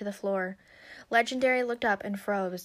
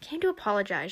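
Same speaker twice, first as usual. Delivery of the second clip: plain reading voice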